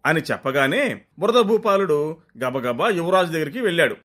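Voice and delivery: plain reading voice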